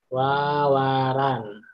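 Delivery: Wawaran spoken very slowly, syllable by syllable; slow teacher spelling-out; drawn out. Shown as a demonstration. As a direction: stretch the word wawaran for the class. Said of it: "kalimat tawaran".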